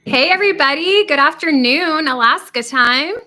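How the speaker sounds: loud, high, cheery hello to the viewers; excited greeting. Real, not acted. Greeting live stream viewers, loud and bright. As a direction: throw a big bright welcome out to everyone.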